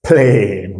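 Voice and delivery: plane said as "plen", long A; model word. Shown as a demonstration. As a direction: give plane the English long A sound. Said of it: "nous avons plane".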